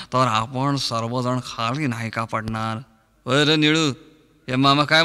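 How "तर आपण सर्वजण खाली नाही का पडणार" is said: slow low drawling character voice. Acted. Mimic addressing father-in-law.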